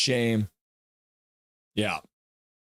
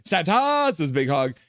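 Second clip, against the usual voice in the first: normal talking voice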